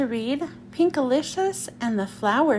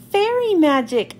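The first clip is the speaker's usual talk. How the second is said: high, mildly cheered exclamation; cheering; 5 semitones higher, 5 dB louder than usual. A narrator's reading. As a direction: cheer it, pitched up with delight. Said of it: "I cheered".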